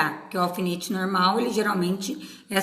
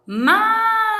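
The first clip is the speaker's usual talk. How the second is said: "Mágica" with MÁ drawn out and pitched high; stress on MÁ; 12 semitones higher, 4 dB louder, slower than usual. Shown as a demonstration. Teacher proving it is proparoxítona, "Mágica" with a long high MÁ. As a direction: sound out "Mágica" slowly, leaning on the first syllable. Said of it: "Então, é uma proparoxítona".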